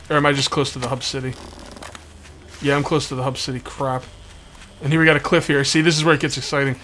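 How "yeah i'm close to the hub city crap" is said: ordinary talk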